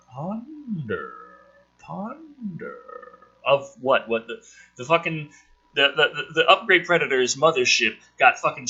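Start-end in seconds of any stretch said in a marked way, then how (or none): 0.1-2.8 playful echo effect; mock echo. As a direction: ponder repeated ever softer, like a fading echo.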